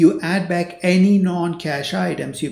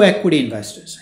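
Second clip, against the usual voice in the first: "equity investors" punched louder, falling at the end; stress on equity investors; 6 dB louder than usual. Shown as a demonstration. Lecturer flags "equity investors" to his students by saying it louder. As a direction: lean hard on "equity investors". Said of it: "I'm emphasizing the word equity investors".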